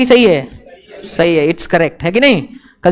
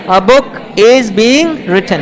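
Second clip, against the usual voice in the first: slow dictation; much slower than usual. Shown as a demonstration. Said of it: "teacher dictates with laugh".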